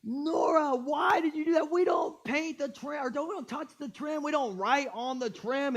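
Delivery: high, frustrated telling-off; mock-exasperated dad lecture; retold angry scolding. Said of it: "And I lost it, man".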